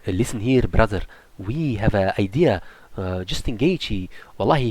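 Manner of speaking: low, scheming manager pitching a plan; lightly voiced conspiratorial talk, low; Ali Abdelaziz voice. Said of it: "they're like".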